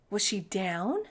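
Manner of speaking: usual speaking voice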